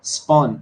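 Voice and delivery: spawn with its O sound stressed. Shown as a demonstration. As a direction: lean on the vowel in spawn. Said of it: "spawn में ओ की sound stressed है".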